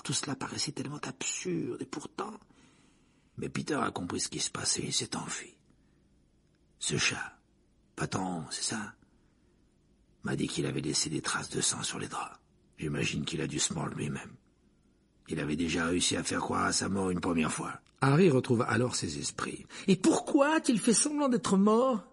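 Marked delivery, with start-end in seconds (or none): 3.4-17.8 low rasping whisper of a haunted man. A narrator's reading. 19.9-22.0 high, slow outraged demand; furious cry. A narrator's reading. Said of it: "s'écria-t-il avec fureur".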